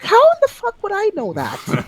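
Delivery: high-pitched exasperated disbelief; incredulous. Retold or imitated. Retelling an absurd accent quiz, high incredulous protest. Acting out baffled reaction to quiz.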